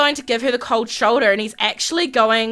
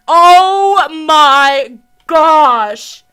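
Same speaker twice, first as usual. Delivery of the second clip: loud, very slow oh my gosh; slow loud stunned gasp of words; drawn-out shock; 2 semitones higher, 7 dB louder, much slower than usual. Real, not acted. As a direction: stretch it out in shock.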